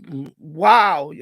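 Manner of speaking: usual speaking voice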